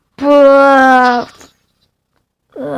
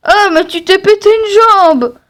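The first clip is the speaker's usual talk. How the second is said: shocked cry; 7 semitones higher, 5 dB louder than usual. Acted. Character sees broken leg.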